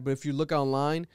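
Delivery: normal talking voice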